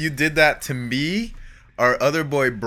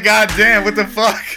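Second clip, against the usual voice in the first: higher, louder mock exasperation; retold outburst of a fed-up lady; imitating pissed-off lady; 7 semitones higher, 4 dB louder than usual. Pissed lady reacting to friends who can't stop laughing, mock annoyed. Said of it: "The lady was pissed at us".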